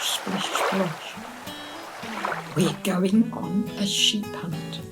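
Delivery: ordinary delivery, nothing marked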